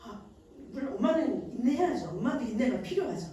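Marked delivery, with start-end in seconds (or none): none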